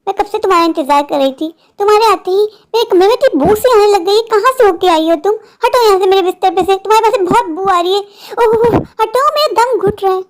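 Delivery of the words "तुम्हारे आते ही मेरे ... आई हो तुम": usual speaking voice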